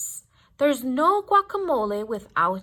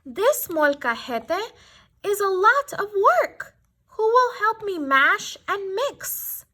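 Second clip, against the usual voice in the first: hen voice; 6 semitones higher than usual. A narrator's reading. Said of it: "she clucked".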